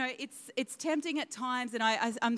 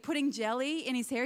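ordinary talk, nothing marked

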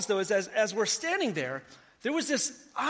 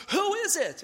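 higher, calling out while running; mock shouted question, pitched higher; retold yell; 9 semitones higher than usual. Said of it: "he yells at me".

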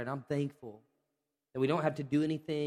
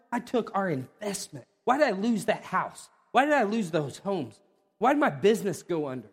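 louder, pressing questions; frustrated why; 5 semitones higher, 5 dB louder than usual. Acted. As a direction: vent the loss. Voicing someone's losses to God.

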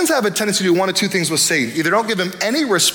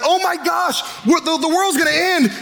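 mock panic, much higher and yelled; panicked worldling; 9 semitones higher than usual. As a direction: squeal it like someone sure the world is ending. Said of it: "everyone's like".

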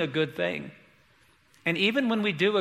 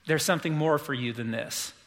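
softer and lower, lightly voiced gentle Jesus; tender, compassionate; 5 semitones lower, 3 dB quieter than usual. Jesus gently calls Martha from the kitchen, tenderly. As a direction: coax her kindly rather than scold. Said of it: "he's not, like, looking down on her and demeaning her".

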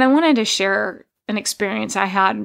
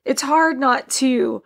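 real tearful voice, pitched up; emotional, higher, with halting pauses; laughing through tears; 4 semitones higher than usual. Real, not acted. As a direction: try to push on while upset. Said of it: "so I can laugh through tears".

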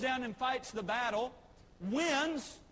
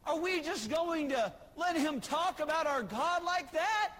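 indignant David; 6 semitones higher, 4 dB louder than usual. Retold or imitated. David outraged at Goliath.

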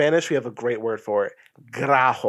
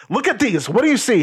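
high, urgent retold questioning; retold freaking out; 9 semitones higher, 4 dB louder than usual. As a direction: reenact agitated insistence, pitch jumped up. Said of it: "I was still freaking out".

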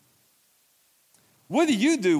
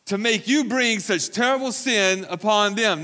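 demanding; 6 semitones higher, 3 dB louder than usual. A narrator's reading. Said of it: "and demanded".

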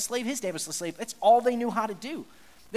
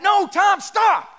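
high, forceful yell; shouted warning; 11 semitones higher, 11 dB louder, much slower than usual. Retold or imitated. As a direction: yell to stop a toddler running into the street. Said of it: "I just shouted out".

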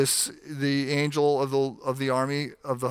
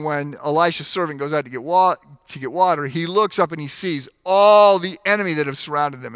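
usual speaking voice

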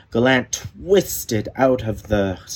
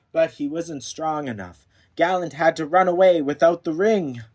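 ordinary delivery

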